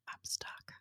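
tiny acted whisper; barely audible, mock-petrified whisper; frozen with fear. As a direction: whisper it as if too scared to move. Retelling being frozen on a beam.

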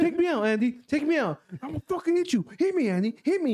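high, insistent goading; retold mad dare. Passenger egging Andy on to hit him, retold mad goading. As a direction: act out getting mad, pushing the dare. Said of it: "I was getting all mad".

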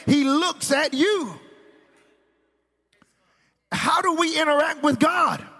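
high, impassioned pulpit delivery rising to a shout; full-force preaching. Real, not acted. Preacher to congregation on gift and giver, impassioned. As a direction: drive the point home to the congregation.

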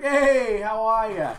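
high, loud hey how are ya; coworker's greeting. Retold or imitated. Coworker greeting him at work, loud and high. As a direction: call it out cheerily across the room. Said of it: "He always comes up to me, and he's like".

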